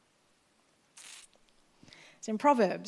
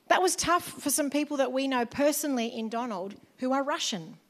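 ordinary delivery

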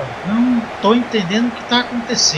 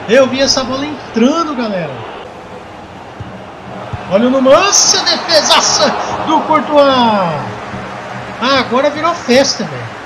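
play-by-play commentary; slower than usual. Acted. Live football play-by-play.

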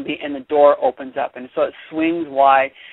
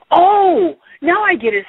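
1 sudden realization; 13 semitones higher, 4 dB louder than usual. A narrator's reading. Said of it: "said Joe".

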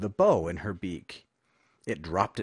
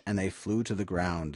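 ordinary delivery, nothing marked

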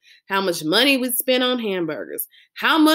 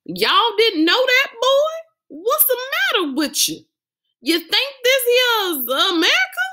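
much higher, hammed-up Southern hillbilly voice; Southern hillbilly accent. Dad egged on by laughing kids, mock Southern hillbilly accent. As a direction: ham up the backwoods Southerner for laughs. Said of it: "say more Southern style stuff".

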